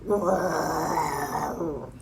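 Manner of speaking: long growling roar; bear's roar. A narrator's reading. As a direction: roar like a bear jumping out. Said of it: "Then the bear jumped up".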